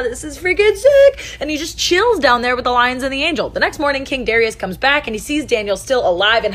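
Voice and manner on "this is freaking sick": higher, slow, gushing delight; thrilled. Acted. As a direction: savor how awesome this is. Daniel thrilled among the lions.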